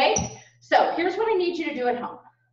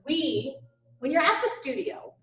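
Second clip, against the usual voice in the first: ordinary talk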